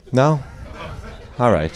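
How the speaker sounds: usual speaking voice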